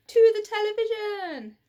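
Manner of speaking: loud, high rallying cry; rally everyone to the TV; children crying out in unison; cried out together. A narrator's reading. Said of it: "they all cried in unison".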